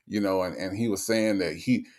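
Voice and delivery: ordinary talk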